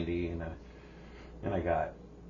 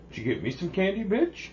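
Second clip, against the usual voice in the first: higher mock voice of a pushy kid; demanding brother; 10 semitones higher, 4 dB louder than usual. The brother demands his candy, high mock whine. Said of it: "he's all".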